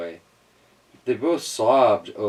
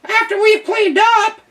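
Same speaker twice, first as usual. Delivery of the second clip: very high, loud meowing cat; a cross cat storming; 9 dB louder than usual. A narrator's reading. Cat telling the departing duck off, high and loud. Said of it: "stormed the cat".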